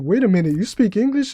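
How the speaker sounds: imitating baffled tourists, high and loud; rising, incredulous question in a put-on voice; mock baffled. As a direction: act out stunned disbelief. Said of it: "so fucking baffled and embarrassed".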